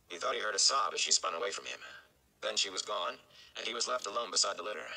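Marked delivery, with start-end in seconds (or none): none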